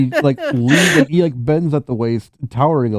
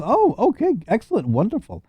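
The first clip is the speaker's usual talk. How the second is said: high, swooping delighted reply; light high-pitched voice for a receptionist; peasant woman; 12 semitones higher than usual. Acted. Staffer welcoming the magic man's party, high and effusive. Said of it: "She says".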